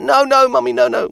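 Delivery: put-on high, pleading toddler; pleading child. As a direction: beg like a scared little girl. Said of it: "she's like".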